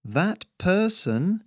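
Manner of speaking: high, drawn-out words with a pause between; slow and plodding. A narrator's reading. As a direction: echo the slow talk right back. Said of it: "his voice just as slow and plodding".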